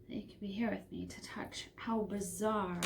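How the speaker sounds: soft unvoiced whisper to the camera. Real, not acted. Vlogger wishing her viewer could touch it, whispered. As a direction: lean in and breathe it intimately.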